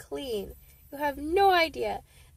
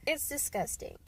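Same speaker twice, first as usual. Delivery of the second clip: ordinary delivery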